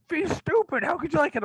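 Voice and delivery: squeaky high scorn, hammed-up; mock scoffing friends. A horror fan mimics friends calling V stupid, high mock scorn. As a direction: sneer it in a cartoonish high voice.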